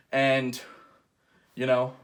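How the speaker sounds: ordinary delivery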